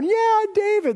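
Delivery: mocking high cheer of Israel; hammed-up sarcastic cheer.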